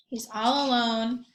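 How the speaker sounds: ordinary talk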